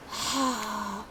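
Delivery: soft, breathy sigh; weary sigh. Acted. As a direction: let out one long, tired exhale. Acting out Wraith's sigh.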